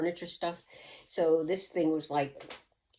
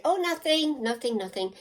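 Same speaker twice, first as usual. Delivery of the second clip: much higher, too-innocent squeak; innocent denial; 8 semitones higher, 5 dB louder than usual. Retold or imitated. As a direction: cover up fast like a caught kid. Caught by mother, denying.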